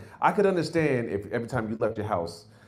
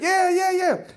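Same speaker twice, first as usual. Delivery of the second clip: much higher, slow, dopey driver voice; Uber driver; 7 dB louder, slower than usual. Retold or imitated. Comic mimics the driver misnaming the comic, high and dopey. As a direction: play the clueless driver, pitched way up. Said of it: "He's like".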